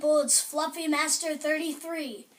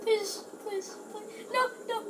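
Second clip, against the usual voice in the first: high, pleading please please; frightened pleading; 7 semitones higher, 4 dB quieter than usual. Real, not acted. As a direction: beg in rising panic. Monster approaching in game.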